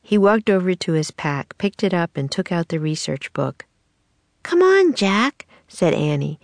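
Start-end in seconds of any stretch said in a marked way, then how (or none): 4.4-5.5 high-pitched urging, lifting on the name; pleading girl. A narrator's reading. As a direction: coax him in a high little-sister voice. Annie coaxes Jack to retry.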